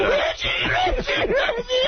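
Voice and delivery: high calls of Reggie, fading; mock yelling. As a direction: team owner calling out Reggie in mock grief. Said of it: "Remember me yelling at Reggie".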